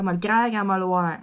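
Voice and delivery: broad Québécois accent. Shown as a demonstration. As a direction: lean into casual Québécois on "croire" and "voir". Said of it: "avec mon accent québécois". (accent québécois bien marqué)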